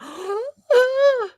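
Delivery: high, drawn-in wince of breath; re-enacted pained gasp; sharp gasp. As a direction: gasp in sympathetic pain. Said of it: "I'm sitting there like".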